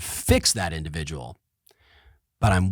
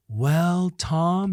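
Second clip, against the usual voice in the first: drawn-out, higher mock lawyer voice; imitating his attorney; 6 semitones higher than usual. Mocking his expensive attorney: drawn-out, higher voice. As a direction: stretch each word like a lawyer billing by the second. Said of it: "that's how he talks".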